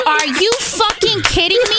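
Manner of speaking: mock outrage through a grin; laughing are-you-kidding-me; high disbelief. Retold disbelief at boyfriend.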